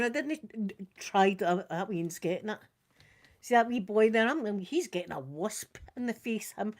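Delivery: ordinary talk, nothing marked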